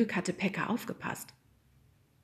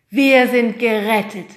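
relieved; 4 semitones higher, 12 dB louder, slower than usual. A narrator's reading. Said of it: "sagte der Lehrer".

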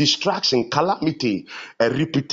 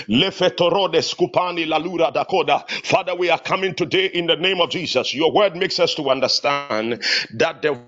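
fervent prayer; 5 semitones higher, faster than usual. Real, not acted. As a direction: pray urgently in driving, repeated phrases. Leads fervent prayer, with tongues.

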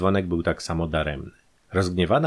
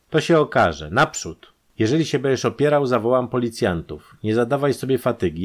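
raised, forceful voice; commanding captor; 5 semitones higher, 4 dB louder than usual. A narrator's reading. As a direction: order the prisoner along loudly. Captor orders the prisoner along.

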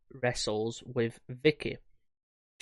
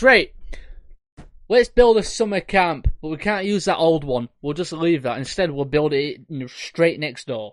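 mock planner voice; 3 semitones higher, 7 dB louder than usual. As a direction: ham up the clueless planner. Mocking the planners' decision.

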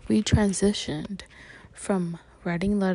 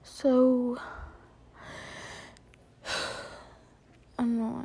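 sighing, short of breath; breathless, air running out; 2 semitones higher, much slower than usual. Real, not acted. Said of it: "I'm out of breath now all of a sudden".

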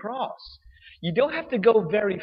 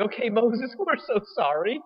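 mock pleading Israelites; 7 semitones higher than usual. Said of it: "they're like".

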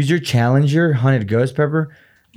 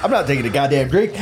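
ordinary delivery

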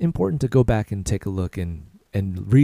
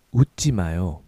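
usual speaking voice

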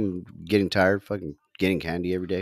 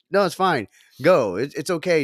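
urging her on; 8 semitones higher, 7 dB louder than usual. Retold or imitated. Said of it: "I'm like".

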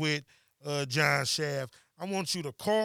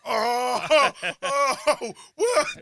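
high, loud laughing disbelief; laughing surprise; 9 semitones higher, 8 dB louder, much slower than usual. Real, not acted. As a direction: burst out laughing in shock. Shocked at absence over Zoom.

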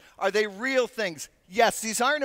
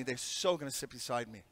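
resigned dread; 8 semitones lower, 8 dB quieter than usual. Retold or imitated. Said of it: "I'm thinking".

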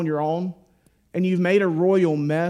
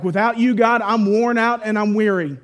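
louder, higher, pleading as if worn out; a louder, higher plea to God; pleading cry; 5 semitones higher, 6 dB louder than usual. Shown as a demonstration.

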